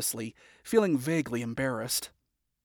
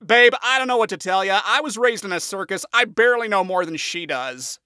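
louder, careless; shrugging imp, unbothered and loud; easy shrug; 6 semitones higher, 7 dB louder than usual. A narrator's reading. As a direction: toss it off with a shrug. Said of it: "with an easy shrug".

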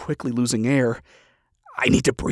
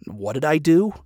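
usual speaking voice

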